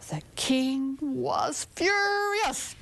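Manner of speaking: slow, breathy whisper of his fury; hushed whisper. A narrator's reading. Storyteller to children, building suspense about the king, whispering. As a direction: draw out furious in a hush.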